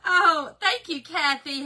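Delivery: ordinary talk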